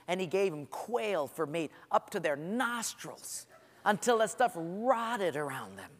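normal talking voice

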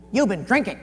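mock yappy accusation; barking. Woman turning back on Churchill, barking her accusation. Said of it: "turned around and barked at him again".

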